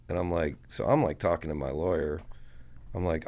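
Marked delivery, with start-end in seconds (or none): none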